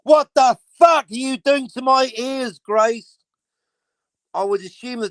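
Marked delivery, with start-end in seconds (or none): 0.0-3.2 higher, louder mock outrage; Not Brian in chat yelling at Grace over her audio, in mock outrage; imitating an outraged shout. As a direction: yell it like he's covering his ears. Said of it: "he'd be going".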